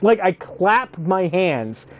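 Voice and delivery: ordinary delivery, nothing marked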